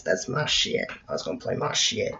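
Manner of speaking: very soft, voiceless half-whisper; hushed aside. Acted. Confiding to viewers about his favourite game, hushed aside. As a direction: drop right down into a whispery aside.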